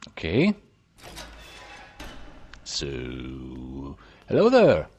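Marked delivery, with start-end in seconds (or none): 4.3-4.9 loud, high-pitched hello; raised, hailing voice; calling out a greeting. Pretend. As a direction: call out to get someone's attention. Greets a game character, calling out.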